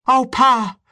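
put-on high, loud child's wail; frightened cry. A narrator's reading. Boy about to be beaten by father, frightened cry. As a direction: cry out as a scared little boy. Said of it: "The little boy cried".

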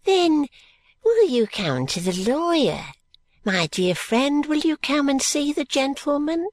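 put-on high, swooping old man's plea; wheedling old schemer pressing his case; urging. A narrator's reading. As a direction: coax him insistently to come along. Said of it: "urges Grandfather Smallweed".

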